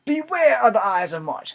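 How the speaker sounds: high, loud theatrical cry; old beggar. Acted. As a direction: call it out in a dramatic beggar's voice. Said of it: "say it with an accent".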